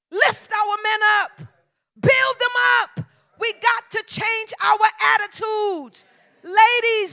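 loud, rising preacher cadence to the church; full preaching. Acted. Preacher exhorting wives in the congregation, loud and driving. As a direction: preach it hard, calling the women to build their men.

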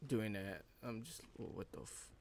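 ordinary delivery

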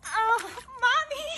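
high yelp from the cold; shocked cry. Real, not acted. Shock of entering ice water.

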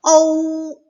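high, loud 어우 called out; loud model syllable. Shown as a demonstration. Teacher modelling 어우 loudly for kids. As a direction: belt out 어우 clearly. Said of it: "십이 번".

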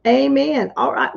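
ordinary talk, nothing marked

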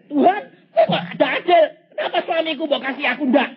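high, fast, loud mock wail; imitated wailing wife. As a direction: play a jealous wife crying out to God. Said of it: "istri saya nangis teriak-teriak".

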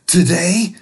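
high, half whispered scheming; a lightly voiced child plotting mischief; sneaky kids. Kids scheme together in a high, sneaky hush. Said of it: "they said".

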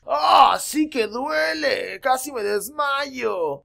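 high, very slow pained wail; hammed-up hurt monster; dragon in pain. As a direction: give up the bluff and whimper. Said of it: "solamente estaba blofeando".

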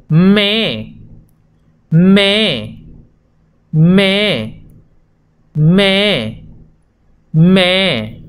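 "me" said as one clear syllable; joined syllable. Shown as a demonstration. Teacher models blended syllable, clear. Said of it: "Si la uno suena".

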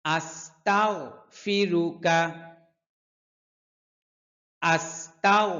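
usual speaking voice

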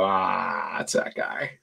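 usual speaking voice

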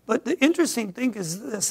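usual speaking voice

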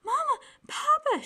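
high, loud, panicked call; frightened cry. A narrator's reading. Sister calls her parents from the dark bedroom, panicked high cry. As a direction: call for help, terrified. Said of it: "she cried".

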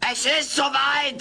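high, slow, alarmed announcement; alarm call. Acted. Alf wakes Kate for a labour drill, high and drawn out. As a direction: sound the alarm.

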